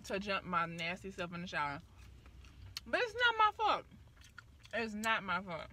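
talking while biting food; chewing between words; mouth full. Real, not acted. Seen 1: Talks normally first; from 6.7 raises food, bites while speaking.

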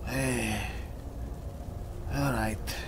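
usual speaking voice

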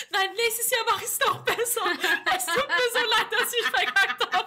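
hammed-up weepy promise, giggling; apologizing through laughing mock tears; mock tearful apology. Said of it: "Und hab ich gesagt".

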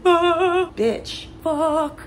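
hammed-up cursing quote; mock outburst cursing. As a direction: yelp the curses in a put-on voice. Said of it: "I'm like".

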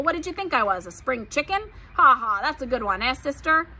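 a hint of a booming show-off, laughing at his joke; lightly voiced hearty pig. A narrator's reading. Big pig Uncle teasing Charlotte with a joke, hearty. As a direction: chuckle through your own weak joke.

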